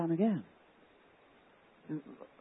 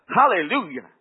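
joyful hallelujah; 4 semitones higher, 11 dB louder than usual. Retold or imitated. First hallelujah, loud.

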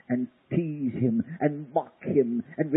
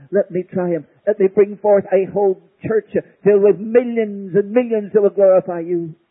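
higher and louder, swelling cry; climactic fervor; 7 semitones higher, 9 dB louder than usual. Acted. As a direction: build to a triumphant cry. Climactic prayer.

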